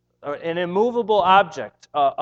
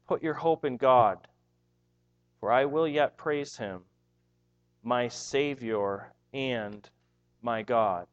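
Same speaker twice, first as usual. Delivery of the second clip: tender self-questioning; 5 semitones lower than usual. Retold or imitated. Psalmist questioning his soul.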